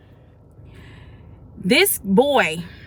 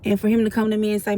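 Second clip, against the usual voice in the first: usual speaking voice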